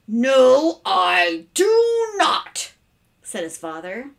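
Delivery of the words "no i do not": loud, very slow, drawn-out words; emphatic refusal. Acted. Father refusing his son's stunt, loud and exasperated. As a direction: stretch each word in exasperation.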